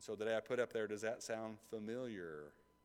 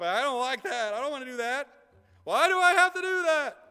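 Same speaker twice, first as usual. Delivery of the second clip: building whine, far higher and louder at the end; whining; 15 dB louder than usual. Pretend. Preacher acting out his own whining about hosting, rising to a loud whine. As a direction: whine like a kid who won't do chores. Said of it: "The preacher was whining".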